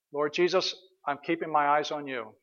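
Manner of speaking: soft, quiet prayer; gentle prayer demo. Shown as a demonstration. Preacher showing the congregation a prayer, soft and tender. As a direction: pray it tenderly under your breath. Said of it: "Let me give you an example".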